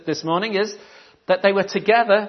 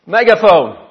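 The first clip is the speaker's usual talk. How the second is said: louder, like calling through a megaphone; loud megaphone emphasis; 7 dB louder than usual. Shown as a demonstration. Preacher explaining the Greek word mega, loudly. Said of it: "So what is it like?".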